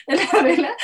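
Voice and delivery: high, giggling through the words; amused giggling talk; laughing. Real, not acted. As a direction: laugh while quoting the candles. Laughing at the candles.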